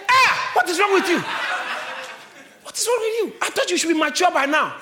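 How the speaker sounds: a sister snapping back at a lazy friend; scolding sister. Retold or imitated.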